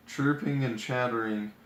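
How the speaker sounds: ordinary delivery, nothing marked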